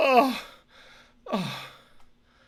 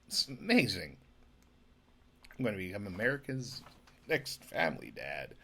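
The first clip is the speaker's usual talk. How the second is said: ordinary talk, nothing marked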